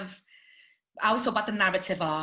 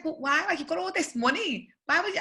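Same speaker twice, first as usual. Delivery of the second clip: incredulous; 9 semitones higher than usual. Retold or imitated. Incredulous questioning of star.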